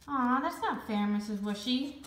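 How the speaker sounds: put-on mildly whiny kid's protest; complaining. A narrator's reading. Boy complaining to his teacher about the assignment, mild whine. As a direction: start high and loud on the "Aw", sulky. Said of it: "Max complained".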